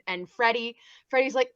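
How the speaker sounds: ordinary delivery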